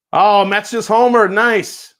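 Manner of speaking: suddenly delighted by the home run news; excited. Real, not acted. Hearing the Mets homered during the stream, excitedly. Reacts to baseball score mid-stream.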